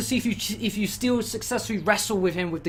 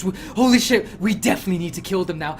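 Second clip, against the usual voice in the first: usual speaking voice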